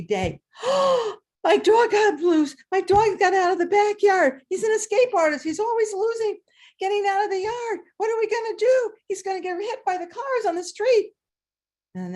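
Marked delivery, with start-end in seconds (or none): 0.6-1.1 sharp, high gasp of alarm; shocked gasp. Retold or imitated. Boy sees his muddy dog loose in the rain, high startled gasp. 1.4-11.1 high, loud, worried rush of words; panicked. Retold or imitated. Boy frets to the class that his dog will get hit by cars, high and loud.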